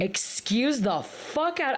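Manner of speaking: high, slow outraged outburst; sudden loud outburst. Real, not acted. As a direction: blurt it out in shock, drawn out. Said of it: "I'm sorry for yelling".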